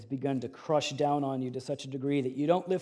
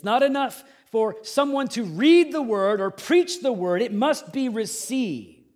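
preaching at full force; 9 semitones higher, 11 dB louder than usual. Acted. Pastor declaring God's plan to the congregation, loud preaching. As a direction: proclaim it to the whole room.

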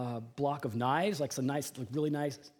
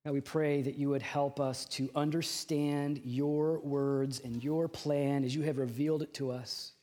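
prayer; 2 semitones lower than usual. Acted. Leads the congregation in prayer.

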